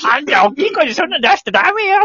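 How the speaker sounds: high-voiced comic telling-off; mock scolding. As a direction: scold the noisy guest in a put-on high voice. Acted scolding of the loud guest. (高い声で叱るおどけた芝居)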